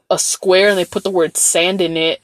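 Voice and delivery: ordinary talk, nothing marked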